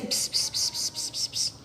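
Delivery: hushed whispered "ps, ps"; whispering a secret. Acted. Maluquinho telling Juninho a secret, whispering. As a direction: whisper gibberish as if into an ear. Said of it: "Ele está falando baixinho".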